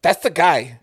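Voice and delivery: high, loud imitation of a small lady; little Filipino lady. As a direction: put on the coworker's voice to point out the kid. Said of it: "this little Filipino lady, and she goes".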